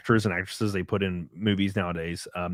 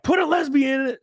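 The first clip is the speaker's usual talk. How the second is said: much higher, a mock demanding executive; mimicking a studio executive's demand; 4 dB louder than usual. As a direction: squeak it out like a silly studio boss. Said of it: "they're like".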